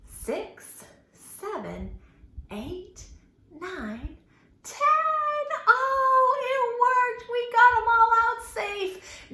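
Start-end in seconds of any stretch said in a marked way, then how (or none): 4.6-9.3 much higher, louder happy sing-out; excited singsong cheer. Pretend. Teacher cheers with the kids that all fingers escaped, high excited singsong. Celebrating fingers got away.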